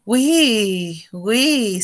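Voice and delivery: ordinary talk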